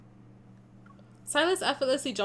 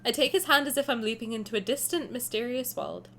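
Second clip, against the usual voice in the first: ordinary talk, nothing marked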